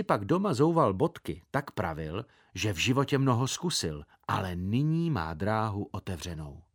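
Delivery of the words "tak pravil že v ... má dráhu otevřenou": ordinary delivery, nothing marked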